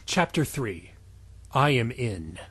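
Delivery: usual speaking voice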